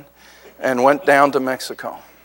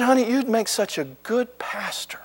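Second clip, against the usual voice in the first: soft breathy whisper, sweetly persuasive; whispered coaxing; 7 semitones higher, 12 dB quieter than usual. Retold or imitated. Wife sweetly coaxing her husband toward Georgia, whispered. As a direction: whisper it like a gentle nudge. Wife gently steers him elsewhere.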